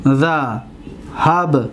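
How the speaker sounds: ذهبت drawn out very slowly in separate syllables, za-hab-tu. Shown as a demonstration. Teacher showing students the example word, syllable by syllable. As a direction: break ذهبت into slow, spaced syllables. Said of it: "ببینید".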